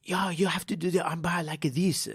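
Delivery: imitating a put-on Brazilian accent; mock Brazilian accent. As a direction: mimic a fake Brazilian jiu-jitsu instructor's accent. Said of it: "Why are you talking like that?".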